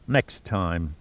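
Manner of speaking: low, quiet snarled threat. A narrator's reading. Boudicca warning Giordino as she lets go, low snarl. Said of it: "she snarled".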